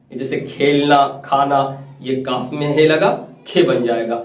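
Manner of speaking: ordinary delivery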